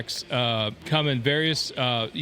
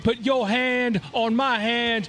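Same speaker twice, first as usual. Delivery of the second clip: Dusty Rhodes voice; 6 semitones higher than usual. Retold or imitated. Dusty Rhodes quote continued.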